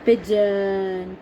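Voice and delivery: ordinary talk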